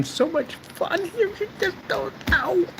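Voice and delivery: very high, drawn-out squeal; squealing with delight; giddy gushing. Real, not acted. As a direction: stretch it into a slow, gleeful falsetto. Gushing about how much fun he has.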